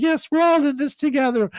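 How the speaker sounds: high, syrupy fake-sincere singsong; mock celebrity sincerity. Mocking celebrities' lockdown messages, high and syrupy. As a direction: gush it like a smug celeb. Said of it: "It's like".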